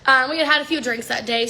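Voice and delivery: ordinary delivery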